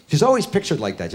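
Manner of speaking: normal talking voice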